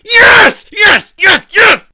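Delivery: loud high victory yells; shouting yes in real triumph; excited yes. Real, not acted. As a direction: cheer over and over. Celebrates winning the guess.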